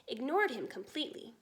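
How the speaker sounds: ordinary delivery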